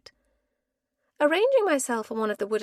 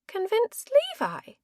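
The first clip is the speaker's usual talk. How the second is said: surprised; 11 semitones higher than usual. Acted. Startled repeat.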